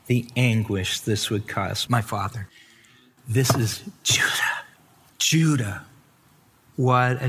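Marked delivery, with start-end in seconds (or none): 3.3-4.7 whispered, the name set apart; hushed whisper. Acted. Preacher whispering the name Judah to the congregation. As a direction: breathe it out like a secret reveal.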